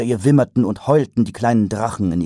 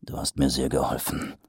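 low, soft, dry; grumbled sarcasm; 7 semitones lower, 7 dB quieter than usual. A narrator's reading. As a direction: mutter it sourly. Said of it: "brummte Mumm".

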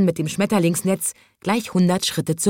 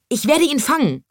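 much higher, boastful call; eager call; 9 semitones higher than usual. A narrator's reading. Thin man with butterfly net, high boastful call. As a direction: sound keen and a bit silly. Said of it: "rief da ein schmächtiger Mann".

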